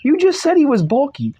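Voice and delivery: very high, squeaky protest; high-pitched reedy voice. Pretend. Objecting to the author about the bulky frame, high-pitched and reedy. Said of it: "give him a very high-pitched, reedy voice".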